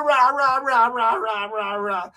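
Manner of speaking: rapid rah-rah tirade, falling away; raucous ranting noise. Acted. Imitating Jagan's tirade in Parliament, raucous babble. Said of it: "y'all think Jagan wasn't rah".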